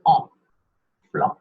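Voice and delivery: "En", then "Flan", both on an open nasal ah with no n; nasal French vowel. Shown as a demonstration. Teacher demonstrating an open mouth, nasal French vowel. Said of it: "Nice and nasally and open your mouth".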